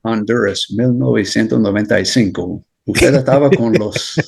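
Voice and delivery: put-on speaking Spanish with a Latin accent; Spanish in a native accent. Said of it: "I love your, your Spanish accent".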